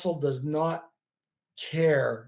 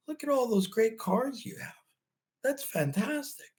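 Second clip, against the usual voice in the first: higher, softer, gentle mother praising her son; soft and high like a mom cheering up her kid, slowing at the end; mother's voice; 7 semitones higher, 4 dB quieter than usual. Retold or imitated. Mother praising his toy cars at night, soft and high. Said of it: "And she'd say".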